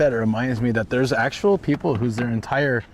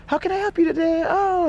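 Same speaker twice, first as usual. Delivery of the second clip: much higher, sugary phone-greeting voice; phone customer service voice. Retold or imitated. Imitating a nightmare manager answering the phone, much higher fake-sweet voice. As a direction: imitate the boss's fake-nice phone manner. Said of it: "she'd be like".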